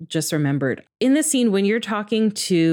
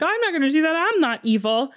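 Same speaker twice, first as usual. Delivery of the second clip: put-on high kid protest; 11 semitones higher, faster than usual. Little Nenji protests.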